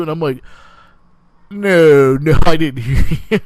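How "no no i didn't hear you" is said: loud denial. Retold or imitated. Reenacting denial to embarrassed woman.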